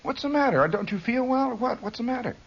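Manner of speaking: high, fussing mother's voice; worried mother. Retold or imitated. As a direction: fret over the kid sitting in the dark. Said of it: "She says".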